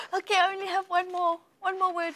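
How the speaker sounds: high, bright-up announcement; excited announcement. Real, not acted. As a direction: build anticipation. Teacher playful excitement.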